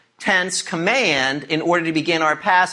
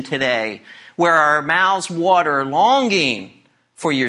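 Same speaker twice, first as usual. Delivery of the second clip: prayer; 4 semitones lower than usual. Real, not acted. Leads the congregation in prayer.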